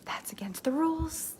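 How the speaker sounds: whispered, scandalized; mock outraged whisper. Ideal client sees a sneaky post, whispering in outrage. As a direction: hiss it like a shocked insider. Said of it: "are watching this going".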